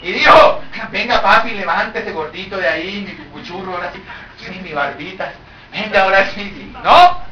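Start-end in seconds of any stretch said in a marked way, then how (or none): 0.9-6.7 soft, cooing "mi cucuchurro, mi barbita"; mock coaxing wife. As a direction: coax him up like a sweetheart, softly. Agar coaxing Abraham tenderly. (Agar mimando a Abraham, en voz suave)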